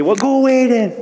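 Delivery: high, petulant joking brush-off; mock huffy. Joking at the rain clouds, a high mock huff. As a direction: tell the clouds off in jest. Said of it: "Just kidding".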